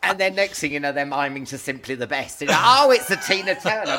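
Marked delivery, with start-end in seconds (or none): 2.5-4.0 louder, higher mock surprise; surprised realisation. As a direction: play the sudden realisation.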